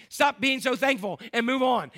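loud, high, exasperated "hurry up" cry; mock impatient outburst. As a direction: blurt it out like a fed-up relative. Impatient family at Thanksgiving table.

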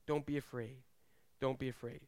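calm a frightened listener; soft reassurance. Acted.

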